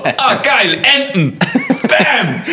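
high, loud, gleeful hunter voice; hungry falcon. Pretend. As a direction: pounce with greedy glee. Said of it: "denkt er so". (gieriger Falke, laut und hoch)

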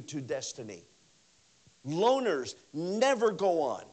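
ordinary delivery

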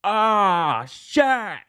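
high, startled mock alarm; playacting dread at footsteps. Retold dream, ghost coming down.

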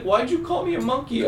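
high, wounded little question; hurt grandma. Retold or imitated. Grandma on the phone asking why, in a high hurt voice. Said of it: "She's like".

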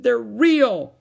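put-on high, very loud childish wail; tearful cry. A narrator's reading. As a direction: cry out, insisting through upset. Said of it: "cried Susan".

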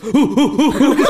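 rapid, high, loud hoots; mistimed Viking chant. Retold or imitated. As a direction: hoot far too fast, missing the rhythm. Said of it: "didn't have a clue about the timing".

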